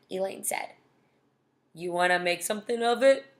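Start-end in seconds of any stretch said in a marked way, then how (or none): 1.7-3.3 low, put-on tough kid; tough guy voice. A narrator's reading. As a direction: challenge them in your best tough-guy voice. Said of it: "in his best tough guy voice".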